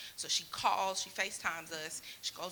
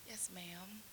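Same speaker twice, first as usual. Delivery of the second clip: meek yes ma'am; 13 dB quieter than usual. Retold or imitated.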